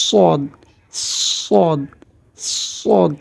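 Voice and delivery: সদ with a long, heavy s; সদ as emphatic "ssod"; heavy Arabic Sad. Shown as a demonstration. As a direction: demonstrate সদ, tongue side against the molars. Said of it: "জিহ্বার দুই পাশে".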